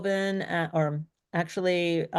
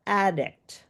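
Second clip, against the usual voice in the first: slow "addict" with a long, stressed AD; stress on first syllable; 2 semitones higher than usual. Shown as a demonstration. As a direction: lean on the AD in "addict". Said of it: "louder and longer part".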